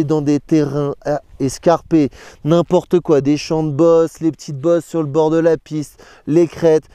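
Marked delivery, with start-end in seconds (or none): none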